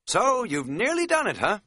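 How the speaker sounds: high-pitched, empty small talk; a trifle inane. A narrator's reading. Hiker just trying to make conversation, a trifle inanely. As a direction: chirp a pointless conversational question. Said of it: "I said, a trifle inanely".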